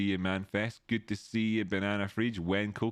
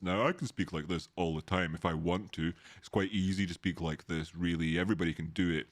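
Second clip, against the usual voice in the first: fake baritone voice; 4 semitones lower than usual. Shown as a demonstration. Streamer demonstrating the fake deep voice he warns viewers against, a put-on low baritone. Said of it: "Because they decide to speak like this".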